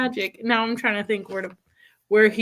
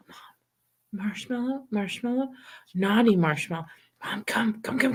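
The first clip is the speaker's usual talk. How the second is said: put-on quiet, secretive child's voice, much softer, whispering by the end; hushed child whisper; 4 dB quieter than usual. Little son at Mom's bedside at night, whispering so Dad stays asleep. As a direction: tiptoe it like a kid sneaking to wake Mom.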